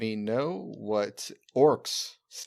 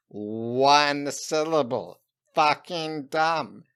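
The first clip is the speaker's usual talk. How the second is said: very slow, drawn-out scorn; much slower than usual. Pretend. Goblin mocking one-syllable names, very slow. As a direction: spell out the contempt word by word.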